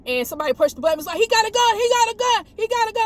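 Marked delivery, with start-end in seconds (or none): 1.2-3.0 high, loud frantic repeat; imitating a panicked caller on the radio; mock panic. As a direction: cry it out like an alarm. Caller pushing panic button.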